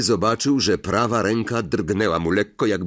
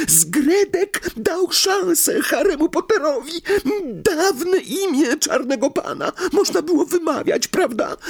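elf command. Acted. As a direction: point and order him out. Elf explains the hint.

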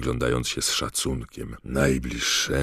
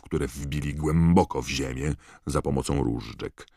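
ordinary delivery, nothing marked

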